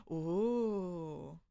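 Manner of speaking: ordinary talk, nothing marked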